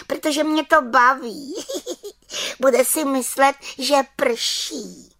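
very high, impish voice with a little snicker; giggling little witch. A narrator's reading. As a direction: titter through it as a mischievous witch. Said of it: "Čarodějnice se pochechtávala". (pochechtávající se čarodějnice, vysokým hláskem)

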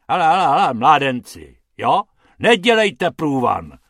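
loud, irritated scolding; growled warning. A narrator's reading. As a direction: warn the youngsters off loudly. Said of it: "zavrčel pan Hrubec".

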